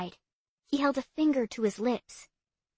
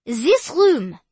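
put-on loud tour guide, French accent; French guide addressing tourists; 5 semitones higher, 8 dB louder than usual. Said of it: "heavy French accent".

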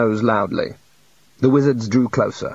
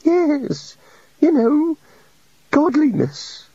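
much higher, a small mopey god voice; high meek little god; much slower than usual. A narrator's reading. The little god confesses to the wizards, meek and high. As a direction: play a shy, sorry deity.

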